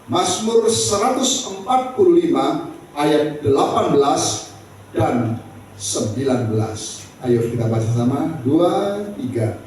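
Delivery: ordinary delivery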